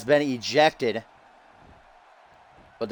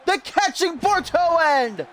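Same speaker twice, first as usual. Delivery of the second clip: excited shout; 14 semitones higher than usual. Real, not acted. Commentator spotting a surprise entrance, shouting excitedly high. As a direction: yell it as the run-in happens. Surprise run-in during the match.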